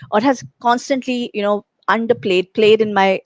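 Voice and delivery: usual speaking voice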